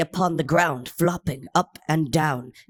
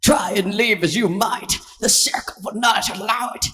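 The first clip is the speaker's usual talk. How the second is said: higher, laughing creature taunt; gloating dolphin; 5 semitones higher than usual. A narrator's reading. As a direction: gloat over the trapped pony. Dolphin gloats at trapped pony.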